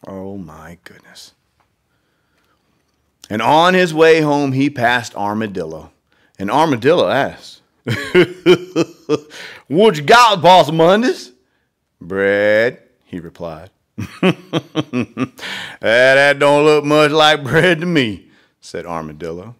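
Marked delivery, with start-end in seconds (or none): 0.1-1.3 whispered aside. Real, not acted. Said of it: "narrator's aside". 7.7-18.3 lightly Southern drawling armadillo, chuckling; a slow Southern drawl with a laugh. Acted. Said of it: "if it's an armadillo, it's gotta be Southern".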